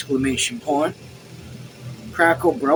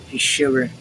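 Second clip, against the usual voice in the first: ordinary delivery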